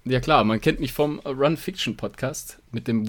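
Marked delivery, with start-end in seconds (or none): none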